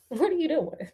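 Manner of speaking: ordinary delivery, nothing marked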